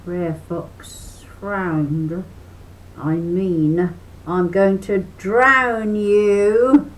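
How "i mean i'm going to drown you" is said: louder, slow fox threat; mild slow snarl. A narrator's reading. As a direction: drag out a nasty threat. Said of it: "he snarled".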